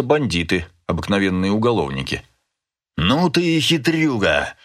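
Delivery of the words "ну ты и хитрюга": high, slow, drawn-out mock roar; sudden roar. A narrator's reading. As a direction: exclaim high, stretching the words, without full volume. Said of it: "вдруг взревел человек". (протяжный сдержанный рёв)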